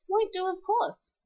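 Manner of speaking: usual speaking voice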